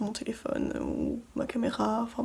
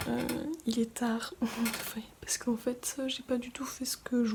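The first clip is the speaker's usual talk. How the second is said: soft late-night whisper; 7 dB quieter than usual. Real, not acted. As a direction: whisper so nobody wakes.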